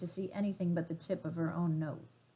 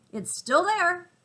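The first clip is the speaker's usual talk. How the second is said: impatient gorilla; 10 semitones higher, 7 dB louder, slower than usual. Acted.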